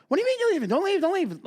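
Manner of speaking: very high, pleading "don't leave"; pleading mock protest. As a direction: beg them to stay, voice jumping up. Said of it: "I'll go".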